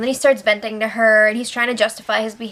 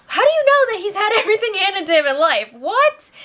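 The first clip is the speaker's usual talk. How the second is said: high, disbelieving question; incredulous; 8 semitones higher than usual. Real, not acted. Vlogger scoffing at the houseguests' claim, high and incredulous. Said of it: "That seems a little ridiculous".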